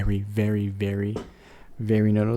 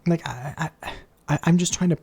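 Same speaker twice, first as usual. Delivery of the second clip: whispered stammer; 5 semitones higher than usual. Real, not acted. Confused about the change.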